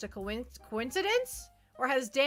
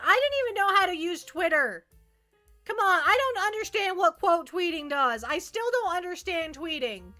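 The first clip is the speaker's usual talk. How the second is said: higher-pitched, baffled protest; exasperated; 6 semitones higher than usual. Real, not acted. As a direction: plead total bafflement, pitch climbing. Mock exasperation about using Twitter.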